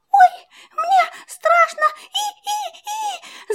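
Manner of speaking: high, scared mouse squeaking; frightened squeak. A narrator's reading. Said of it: "запищал мышонок".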